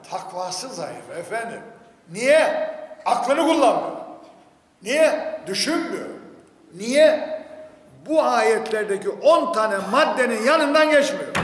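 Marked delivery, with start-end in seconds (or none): 2.1-11.3 loud, high rhetorical questions and answers; impassioned preaching. Acted. Preacher faulting believers for not using reason, impassioned and loud. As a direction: fire out each why, then answer forcefully.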